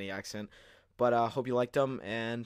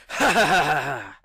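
evil laugh; 6 semitones higher, 13 dB louder than usual. Acted. Villain laughing in triumph.

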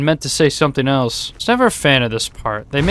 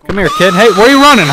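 calling out; 7 semitones higher, 7 dB louder, faster than usual. Real, not acted. Gamer shouting at a fleeing kid, loud and high. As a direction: shout after him as he runs.

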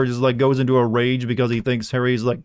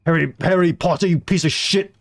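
higher, louder mock rage; hammed-up angry wizard yell; furious dub Dumbledore; 6 semitones higher, 3 dB louder than usual. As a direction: bellow at Harry like a dubbed-over Dumbledore. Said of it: "the guy's literally like".

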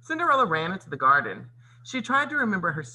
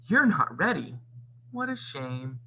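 sneered; 2 semitones higher than usual. A narrator's reading. Stepmother gloats that Cinderella will miss the ball, sneering. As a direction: sneer with mock sympathy.

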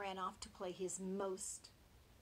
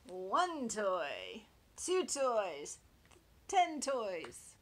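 counting; 9 dB louder, much slower than usual. Acted. Piglet counting toys, skipping.

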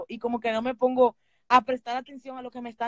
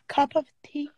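mock British correction; 2 semitones higher, 3 dB louder than usual. Shown as a demonstration. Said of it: "No, no, no".

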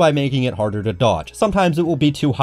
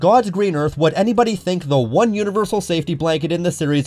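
disbelieving angry outburst; exasperated rant; 6 semitones higher than usual. Real, not acted. Ranting about the removed E-Tanks.

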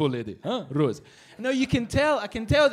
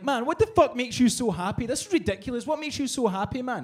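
exasperated question; 7 semitones higher than usual. Retold or imitated. Asking the rose seller, exasperated.

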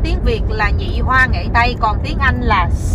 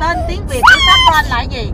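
exasperated shout. Real, not acted. Mother shouting the question at her child.

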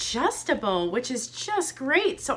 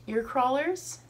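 ordinary talk, nothing marked

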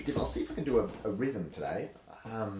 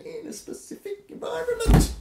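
squeaky high climax; 13 dB louder than usual. Shown as a demonstration. Climax of the dramatic reading.

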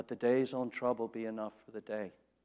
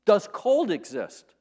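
loud, higher, slow pointed question; bold question; 7 semitones higher, 6 dB louder, slower than usual. Acted. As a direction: challenge the professor, word by word. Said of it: "He says to the professor".

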